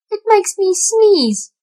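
sneezy kitten. A narrator's reading. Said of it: "said Tom Kitten".

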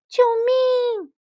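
high, loud, panicked call; high storybook scream for rescue; urgent cry for help. A narrator's reading. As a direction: cry out as a drowning little mole. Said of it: "着急地呼救".

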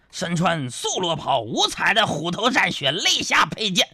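grand recital climbing to a high peak; storyteller's declamation. Acted. Comedian introducing a waiter as armored hero, swelling storyteller declamation. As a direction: build it like an old-style storyteller.

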